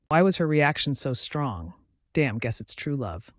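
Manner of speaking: ordinary delivery, nothing marked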